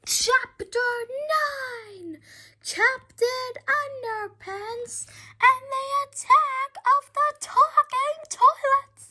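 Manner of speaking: a showman booming out the title; grand title announcement. Acted. Child reading his comic's title, hammed-up announcer voice. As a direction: announce the title high and big.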